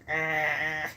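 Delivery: low curled-lip growl, dog style; mock dog growl. Imitating Sandy's curled lip, a low mock growl. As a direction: do the dog's grumpy lip-curl growl. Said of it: "She gets that lip going".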